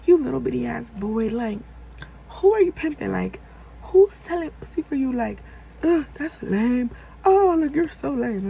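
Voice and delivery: high, loud mocking putdowns; retold scornful rant, punching the insults; attacking. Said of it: "I'm attacking his opinions".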